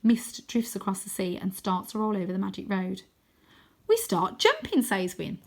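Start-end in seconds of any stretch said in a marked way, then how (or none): 3.9-4.8 put-on high, bouncy boy voice; eager and high; Wyn's voice. Wyn urging Arthur to start jumping, high eager voice.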